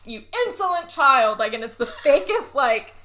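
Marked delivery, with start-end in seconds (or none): 0.0-1.4 higher, louder scolding father; acted indignation. Father slapping his daughter, mock scolding. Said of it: "then slaps her and is like".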